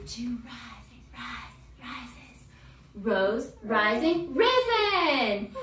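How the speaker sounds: teacher building the chant from quiet to loud; soft to loud build. Shown as a demonstration. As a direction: start soft and climb to a loud call. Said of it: "start low and we're gonna whisper ... get louder".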